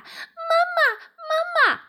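very high, loud tadpole shouts; calling out. A narrator's reading. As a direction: call after mum in a piping shout. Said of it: "于是连声大喊". (小蝌蚪高声喊妈妈)

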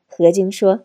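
usual speaking voice

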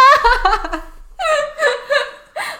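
high laugh tipping into a cry; laughing to tears. Real, not acted. Laughing helplessly at the grandpa's cries. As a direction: laugh till you nearly cry.

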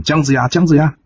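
high calling of a name from afar; a voice behind him calling Jiang Ziya, pitched high. Acted. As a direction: call after someone walking away. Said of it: "就听到后面人喊".

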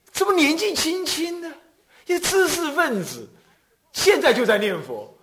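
mocking classmate. University classmate scoffing at the young monk, high and scornful. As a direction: sneer at him for chanting so young.

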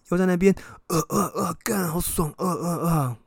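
high strained mock groaning; hammed-up imitation of grunting; imitated awkward groans. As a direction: mimic his stifled uh-uh sounds. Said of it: "又来".

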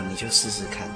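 usual speaking voice

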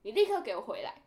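loud barked order; imitating a furious officer; fierce command. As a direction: order her back at once. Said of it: "超凶".

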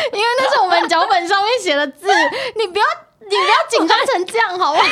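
high laughing teasing. Real, not acted. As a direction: laugh while scolding. Laughing at partner's nerves. (笑着调侃)

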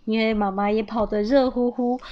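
plain reading voice

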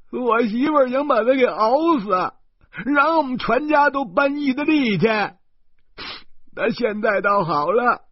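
高声哭诉，边哭边说; 抱着哥哥的腰嚎啕哭诉; 哇哇大哭. A narrator's reading. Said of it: "他哇哇大哭". (弟弟抱着哥哥哇哇大哭地哭诉)

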